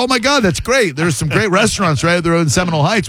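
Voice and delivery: high-pitched chatty enthusiasm; eager, high retold excitement; retold gushing. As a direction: gush as if thrilled by the small talk. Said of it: "I go".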